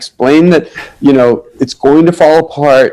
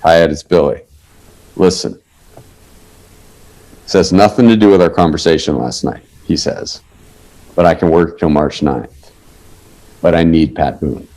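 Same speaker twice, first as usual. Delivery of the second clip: low Billy Bob voice; 9 semitones lower, 3 dB quieter than usual. Retold or imitated. Billy Bob calls at lunch.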